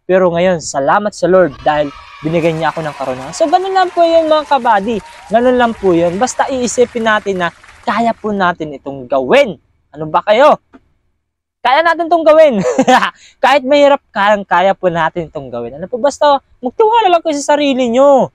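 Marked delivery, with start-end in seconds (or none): none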